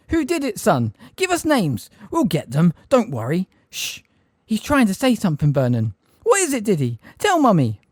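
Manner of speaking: high, loud frantic parents; frantic questioning. A narrator's reading. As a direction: parents pressing their son for names.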